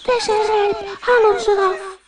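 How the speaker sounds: very high little bread voices calling; tiny voices. Acted. Little loaves call to Floortje from the oven, tiny and high. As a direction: squeak like small loaves. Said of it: "een heleboel kleine stemmetjes roepen".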